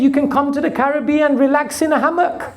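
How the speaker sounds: quick, laughing through the words; laughing punchline. Retold or imitated. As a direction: chuckle as you deliver the punchline. Punchline of the boatman story.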